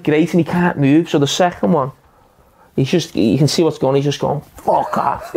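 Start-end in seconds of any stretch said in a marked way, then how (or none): 4.6-5.3 whispered curse under the breath; a striker's quiet threat before a corner; hissed curse. Retold or imitated. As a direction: spit it out low and menacing. Opponent muttering before the elbow.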